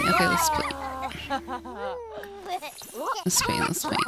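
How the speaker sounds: high, squeaky play-along voice; cutesy high-pitched cheering. Acted. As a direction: chirp it like an excited kid.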